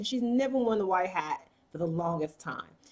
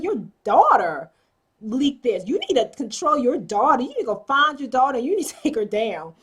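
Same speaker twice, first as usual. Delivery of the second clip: angry retold scolding; 3 semitones higher, 5 dB louder than usual. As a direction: hammer each "daughter" like an angry father-in-law. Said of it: "Edison is pissed".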